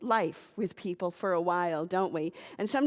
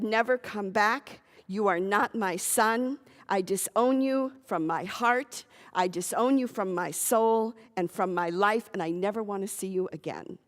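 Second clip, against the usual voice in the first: normal talking voice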